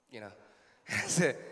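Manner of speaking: ordinary talk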